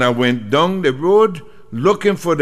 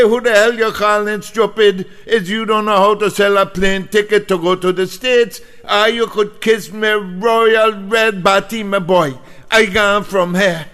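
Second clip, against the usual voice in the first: angry; 6 semitones higher than usual. Retold or imitated. Storms out insulting.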